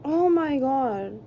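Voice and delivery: loud, slow exasperated groan of words. Real, not acted. Seeing the spilled noodles, slow loud exasperation. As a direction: drag it out, fed up.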